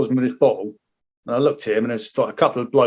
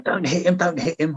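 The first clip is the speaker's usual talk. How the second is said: officer still pleading; 5 semitones higher than usual. Retold or imitated. Officer keeps urging soldier to leave kid alone, mild imitation. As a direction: echo the officer's fretful plea, lightly voiced. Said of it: "This officer was still going".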